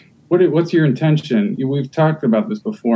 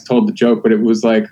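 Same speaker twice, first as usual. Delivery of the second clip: normal talking voice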